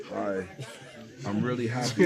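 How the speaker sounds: low, subdued imitation of a bored delivery; mock monotone. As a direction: drone it lifelessly, mocking a dull vlogger. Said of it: "terminology is monotone. Your voice is like".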